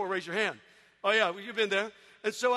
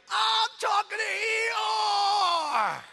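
put-on excited kid shout; 10 semitones higher, 4 dB louder, much slower than usual. Little brother bragging.

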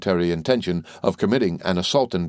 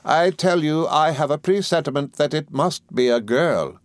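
high-pitched, emphatic aunt; insistent aunt; 8 semitones higher than usual. A narrator's reading. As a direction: declare it with an aunt's certainty. Voices Miss Betsy.

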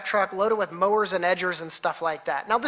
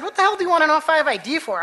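fast, higher, louder indignant outburst; angry retort; 9 semitones higher, 6 dB louder, much faster than usual. Retold or imitated. Teacher snapping back at the agent demanding ID, fast indignant retort. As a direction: fire the question back, offended and quick.

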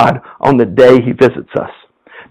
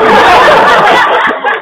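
high breathy burst of laughter; laughing. Real, not acted. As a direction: giggle at the absurd drill. Laughs at own story.

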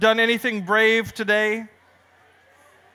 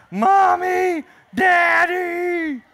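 much higher scared calling out; calling for parents; 10 semitones higher, 3 dB louder, much slower than usual. Acted. Little boy calling his parents in the dark, scared calling out. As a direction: cry out for Mom and Dad. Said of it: "And he goes".

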